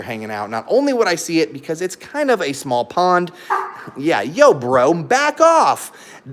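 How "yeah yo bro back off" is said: loud, very slow warning to the dog; firm telling off. Real, not acted. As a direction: back off, bro, said slow and loud.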